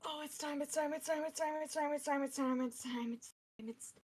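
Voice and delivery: jittery, soft run-on panic; anxious vibrating king. Acted. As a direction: chant it nervously without a breath, fading out. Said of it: "physically vibrating. He's like".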